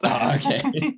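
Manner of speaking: usual speaking voice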